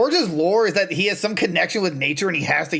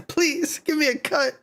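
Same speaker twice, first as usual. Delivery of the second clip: begging; 10 semitones higher than usual. Pretend. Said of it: "I would be like".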